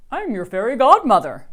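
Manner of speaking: loud, high quirky voice; little man's voice. A narrator's reading. Little man greets crying Rascal, loud quirky voice. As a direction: announce it grandly but oddly.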